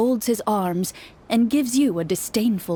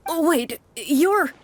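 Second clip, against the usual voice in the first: higher, startled realization; anxious townsman suddenly recognizing the hunter; sudden recognition; 7 semitones higher, slower than usual. Acted. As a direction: catch yourself mid-word as it dawns on you.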